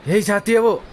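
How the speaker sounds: sudden high, loud exclamation; loud outburst. Acted. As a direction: blurt it out loudly, pitch jumping. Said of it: "ज़ोर से बोल दिया". (अचानक ज़ोर से, ऊँची आवाज़ में)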